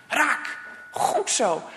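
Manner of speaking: ordinary delivery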